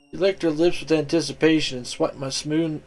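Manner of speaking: usual speaking voice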